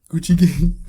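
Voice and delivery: hammed-up sad version of a rap hook; mock sad. Shown as a demonstration. Showing co-host it works as a sad song, mock sad. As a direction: sing the hook as if heartbroken, for laughs. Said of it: "think about it as a sad song".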